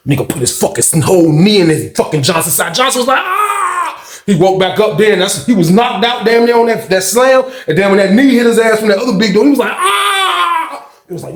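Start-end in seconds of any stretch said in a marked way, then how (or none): 3.2-4.2 high-pitched mock wail; imitated pain scream. Imitates Johnson's cry of pain. 9.7-10.9 long high mock wail; imitated pain scream. Imitates Johnson's cry of pain.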